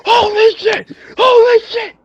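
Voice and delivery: very high, loud yelp of delight; excited shriek. Real, not acted. Fan losing it over the Million Dollar Man, shrieking high and loud. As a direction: screech it out in real disbelief. Excited reaction to wrestling moment.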